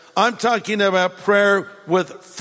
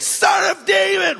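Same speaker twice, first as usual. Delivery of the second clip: loud cry; 12 semitones higher than usual. Retold or imitated. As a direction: shout it much higher and louder, a blind man calling out. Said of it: "really loudly".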